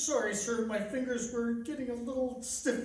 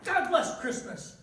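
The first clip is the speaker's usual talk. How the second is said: impulsive cheer; 3 semitones higher, 4 dB louder than usual. Acted. Clerk cheers the nephew's speech.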